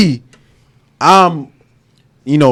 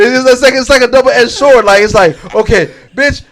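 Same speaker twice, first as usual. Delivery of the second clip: loud rant; 9 semitones higher, 6 dB louder than usual. Real, not acted. Heated rant about girlfriends.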